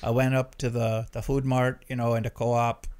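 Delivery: put-on emphatic Minnesota accent; put-on Minnesota errand story. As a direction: tell it like a chatty Minnesotan. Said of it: "the Minnesota voice there".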